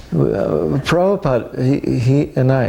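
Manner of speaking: high-pitched, stammering protest; flustered. Retold or imitated. Rejected suitor invoking his guru, flustered. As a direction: imitate yourself fumbling for an answer.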